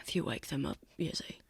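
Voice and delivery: low whispered warning; hushed, controlled menace; menacing whisper. Acted. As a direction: whisper a threat so the sleepers don't hear. Assassin warns captive near sleepers.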